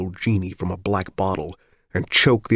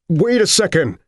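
loud, higher shout; desperate yell; 11 semitones higher, 6 dB louder than usual. Acted. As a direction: yell at the operator to wait. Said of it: "Richards yelled".

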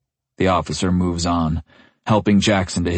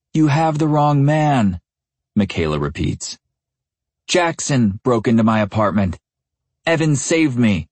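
firm and carrying; 6 semitones higher than usual. A narrator's reading. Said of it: "Her voice carries".

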